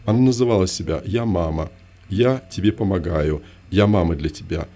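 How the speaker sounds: ordinary delivery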